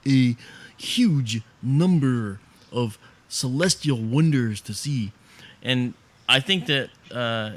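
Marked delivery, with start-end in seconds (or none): 0.1-5.1 slow, drawn-out awed voice; lightly voiced Sagan wonder; Carl Sagan impression. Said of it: "or as Carl Sagan might say".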